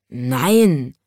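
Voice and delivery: low grumbled no; grumbling lion. A narrator's reading. Tiny lion grumbling no to the wizard, low. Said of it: "brummte der winzige Löwe".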